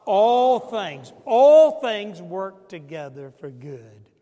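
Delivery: loud, slow scripture proclamation; preaching. Acted. Pastor proclaiming Paul's words, loud and slow. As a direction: proclaim each word slowly and loud.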